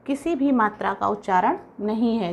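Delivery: ordinary delivery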